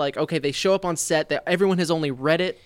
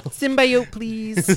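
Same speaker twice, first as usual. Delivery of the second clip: mock unhinged plea; 5 semitones higher, much slower than usual. Mock acting out of her insane line.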